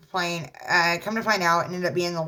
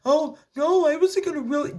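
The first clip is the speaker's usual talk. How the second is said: high whiny mock excuse, louder; mocking husband; 11 semitones higher, 4 dB louder than usual. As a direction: whine his lame excuse in a squeaky voice.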